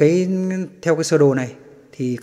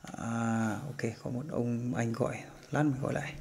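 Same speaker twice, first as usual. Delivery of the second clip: quiet aside; 3 semitones lower, 12 dB quieter, slower than usual. Real, not acted. Aside about a phone call.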